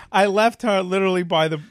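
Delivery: usual speaking voice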